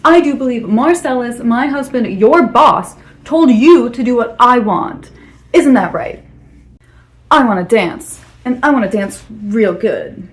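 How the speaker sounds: loud, emphatic demand from an affronted boss's wife; indignant. Acted. Boss's wife ordering her husband's employee to dance, indignant. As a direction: pull rank, hitting the key words hard.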